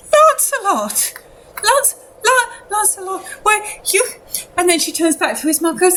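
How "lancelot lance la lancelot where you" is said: high, frantic mother's voice; distraught mother. Acted. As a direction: cry out the name in a high, agitated voice. Acting distraught mother seeing Lancelot.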